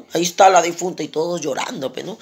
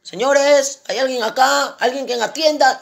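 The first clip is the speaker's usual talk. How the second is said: high, raised calling voice; 8 semitones higher, 3 dB louder than usual. Acted. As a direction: call out for anyone to serve you. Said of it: "comienza a gritar". (llamando a voces, en tono agudo)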